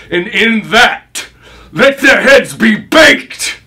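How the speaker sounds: building vengeful fury. Acted. Avenger orders the grisly cooking, building to a shout.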